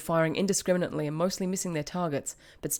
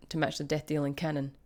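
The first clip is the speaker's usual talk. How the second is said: usual speaking voice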